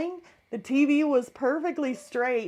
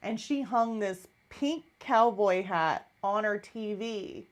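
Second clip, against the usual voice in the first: ordinary talk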